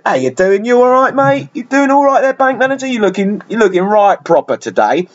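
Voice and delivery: cheeky South London street greeting; South London accent. Retold or imitated. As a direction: overfamiliar South London lad. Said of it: "Instead of talking like that".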